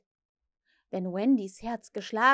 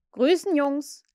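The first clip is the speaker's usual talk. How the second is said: loud, high greeting; hearty called greeting; calling out; 4 semitones higher, 6 dB louder, slower than usual. A narrator's reading. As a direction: hail the gang loudly. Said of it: "rief er".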